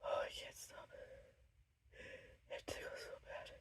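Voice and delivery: strained breathy whisper; whisper, fighting the tickle. Real, not acted. A sneeze coming on.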